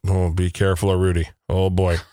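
low, ominous imitation of a co-worker; mock warning. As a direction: warn darkly, voice dropped. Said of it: "she goes".

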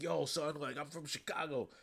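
a light Chicago street accent, imitating Riri; lightly voiced Chicago teen bragging; Chicago slang voice. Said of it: "I don't know how to talk like this".

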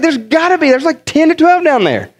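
high-pitched insistent comeback; acted-out exasperated reply; retold insistence. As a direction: insist in mock disbelief. Acts out coworker insisting.

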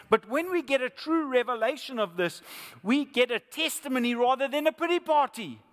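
loud, high, impassioned sermon climax; preaching at full force. Acted. Preacher rousing the congregation, at full force.

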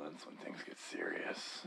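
hushed, slow whisper. Pretend. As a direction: lean in and whisper it. Suggestive joke, dropped to whisper.

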